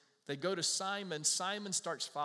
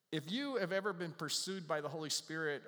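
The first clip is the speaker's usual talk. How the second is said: ordinary talk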